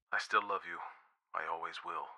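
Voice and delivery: very soft, tender reassurance; softly. A narrator's reading. Boyfriend reassuring girl on the phone, very softly. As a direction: murmur it, fading to almost nothing. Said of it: "he said softly".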